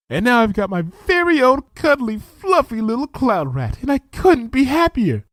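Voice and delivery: a shy pony overjoyed by her new pet; delighted Fluttershy. Acted.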